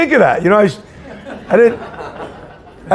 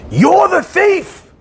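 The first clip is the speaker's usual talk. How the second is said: drawn-out high-pitched yell; retold scream; 11 semitones higher, much slower than usual. Boss accusing the boy before the whole office, retold scream. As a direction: cry it out high and stretched, imitating a screamed accusation. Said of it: "he screams on the top of his lungs".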